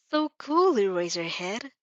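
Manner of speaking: sneering, with a smirk you can hear; smirking taunt. Acted. Villain mocking Aizawa, smirking and sneering. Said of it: "lips twist in a nightmarish smirk".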